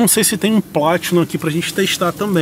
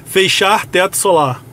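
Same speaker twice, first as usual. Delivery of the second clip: slow, clear spoken command; talking to voice assistant; 3 semitones higher, 4 dB louder, slower than usual. Real, not acted. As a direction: give the car a voice command. Voice command to car system.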